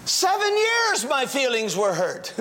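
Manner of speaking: fervent sermon push; preaching. Acted. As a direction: urge the congregation to act. Preacher's exhortation.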